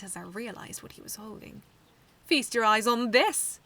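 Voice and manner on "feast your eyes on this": loud, slow sneering boast; loud, slow gloating sneer. Acted. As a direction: sneer as you show off the prize. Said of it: "he sneered".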